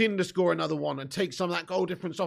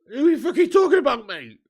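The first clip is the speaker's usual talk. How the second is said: louder, higher and fast, laughing through it; exasperated outburst; 5 semitones higher, 7 dB louder, faster than usual. Real, not acted. Streamer baffled by a chat comment, laughing exasperation. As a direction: blurt out in disbelief.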